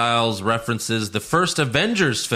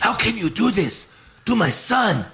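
whisper yelling; 5 semitones higher than usual. Retold or imitated. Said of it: "Rey Mysterio whisper yells at Adam Pearce".

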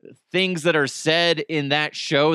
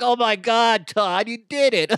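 mock coworker excitement; 7 semitones higher, 4 dB louder than usual. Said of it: "They're gonna be like".

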